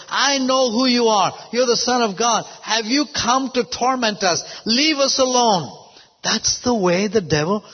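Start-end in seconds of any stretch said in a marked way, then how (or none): 0.1-5.7 acted demons. Demons manifesting before Jesus in Nazareth, acted high protest. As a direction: cry out as demons confronted, lightly hammed-up. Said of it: "the demons manifest".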